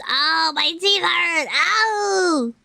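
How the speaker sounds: high whining about pain; whiny pain. Real, not acted. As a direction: whimper from tooth pain. Bit ice, teeth hurt.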